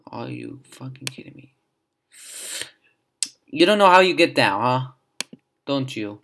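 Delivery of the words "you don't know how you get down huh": taunting. Real, not acted. Taunting the panda.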